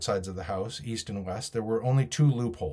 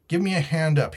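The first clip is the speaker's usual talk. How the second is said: higher-pitched call for help; cried out; 6 semitones higher, 5 dB louder than usual. A narrator's reading. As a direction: call out with a moderately raised voice. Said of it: "he cried".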